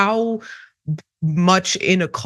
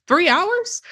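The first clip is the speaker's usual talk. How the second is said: incredulous; 11 semitones higher than usual. Real, not acted. Scoffing at the three-hour wait.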